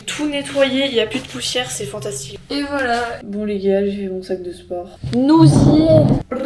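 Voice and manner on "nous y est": silly drawn-out voice. Acted. Drawn out, loud, odd voice.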